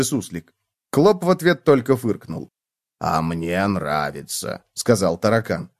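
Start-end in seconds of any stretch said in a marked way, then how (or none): 3.0-4.6 low, slow, soft bug voice; a slow, low-down cockroach. A narrator's reading. Cockroach voice in story.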